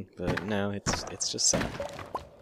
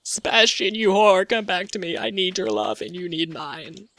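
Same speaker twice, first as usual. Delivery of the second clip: high, loud, hammed-up desperate love; melodramatic lover's plea; 10 semitones higher, 5 dB louder than usual. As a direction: beg your lover to come back, soap-opera style. Dramatic plea to Sebastian.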